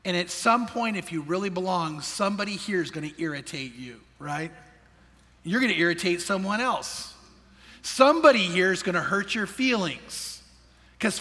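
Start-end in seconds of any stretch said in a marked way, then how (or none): none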